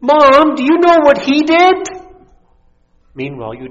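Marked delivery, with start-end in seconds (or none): 0.0-1.9 put-on high, loud whiny kid; whiny tattling child. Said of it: "tattle with a whiny voice".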